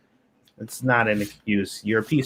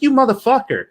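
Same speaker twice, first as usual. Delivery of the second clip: exasperated retold outburst, higher and louder; retold exasperation; 11 semitones higher, 4 dB louder than usual. Retelling scolding his friend who stole, mock outraged. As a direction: play back your own incredulous rant at a thieving friend. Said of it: "I'm just like".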